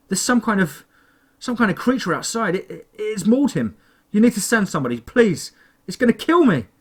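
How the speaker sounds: high, loud, stammering panic; rising terror, begging for help; panicked plea. A narrator's reading. Panicked call to emergency line.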